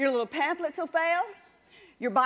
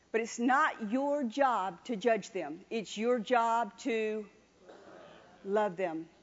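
tender emphasis; 5 semitones lower, slower than usual. Acted. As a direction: lay love them down gently. Preacher softens on love.

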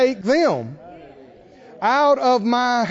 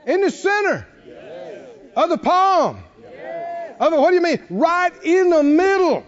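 full-force preaching; 6 semitones higher than usual. Real, not acted. Preacher urging his church, rising to a shout. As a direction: drive it home to the congregation.

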